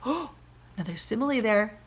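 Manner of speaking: plain reading voice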